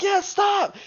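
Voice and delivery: high, loud cries to stop; mock frantic plea. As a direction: act out a player yelling in protest. Retold player yelling to stop.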